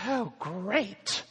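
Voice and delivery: high, anxious fretful woman. Acted. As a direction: fret about it nervously. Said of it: "said Miss Fear".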